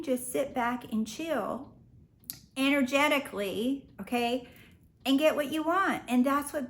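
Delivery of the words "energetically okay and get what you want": ordinary delivery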